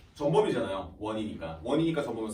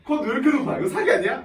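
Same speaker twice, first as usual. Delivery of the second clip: loud, high, laughing outcry of disbelief; shocked disbelief; 10 semitones higher, 7 dB louder than usual. Retold or imitated. Student seeing the high cutoff, loud laughing shock. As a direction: blurt it out like the cutoff just floored you.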